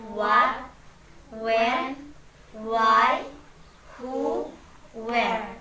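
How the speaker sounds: loud, word by word drill; slow and loud. Shown as a demonstration. Teacher leads pupils in reading question words, slow and loud. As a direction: call each word out slowly, with gaps. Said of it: "Pelan-pelan tapi suaranya keras".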